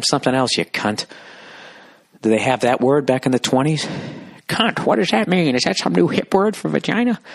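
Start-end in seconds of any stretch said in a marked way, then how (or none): 4.5-7.2 high, put-on old man asking innocently; mock neighbour voice, high and innocent. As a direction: play the clueless old man, pitched way up. Mocking old neighbour again.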